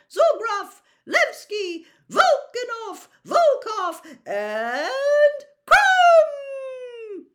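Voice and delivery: high, loud booming announcer voice; stadium announcer. A narrator's reading. Announcer calling player names.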